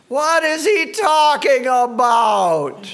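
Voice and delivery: hammed-up outraged shout at the teacher; mock shout. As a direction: bellow the question like a baffled heckler. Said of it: "that wasn't the exact tone of voice that they used".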